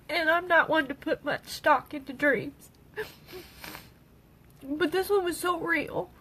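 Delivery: crying. Real, not acted. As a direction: speak through real tears. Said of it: "sorry for all the tears".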